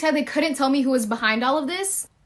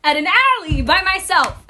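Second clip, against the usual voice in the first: laughing; 5 semitones higher than usual. Real, not acted. Laughing while retelling scary meeting.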